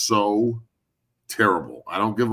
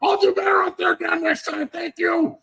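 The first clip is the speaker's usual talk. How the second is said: high, squealing mock ecstasy; Sean Payton; 4 dB louder than usual. Masochist coach thanking the player who whips him, mock-delighted squeal. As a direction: squeal gratefully, hammed way up. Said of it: "And he's like".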